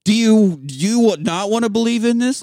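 hammed-up frustration, pitched high; mock exasperated husband. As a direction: badger the wife with an incredulous question. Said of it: "he's gotta talk to her in questions only".

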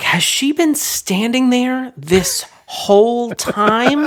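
bewildered. Retold or imitated. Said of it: "I'm like".

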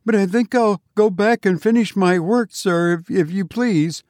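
high, faltering, pleading politeness; nervous sexton. A narrator's reading. Sexton begging leave to finish work, nervous and high.

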